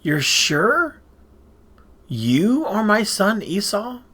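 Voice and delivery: probing question, drawn out and lifted at the end; pressing. A narrator's reading. Old Isaac pressing his son before blessing him, pressing doubtfully. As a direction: press for a sure answer. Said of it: "he pressed him".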